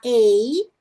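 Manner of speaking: "ei" drawn out as two sounds, gliding; stretched letter-name "ei"; long A sound. Shown as a demonstration. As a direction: say "ei" as a clear diphthong. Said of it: "som longo da letra".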